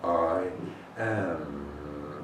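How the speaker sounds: I am intoned low, stretched, with weight on the A of am; deep meditative I am. Shown as a demonstration. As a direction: chant I am low, leaning on the A. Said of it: "The emphasis on the A of the am".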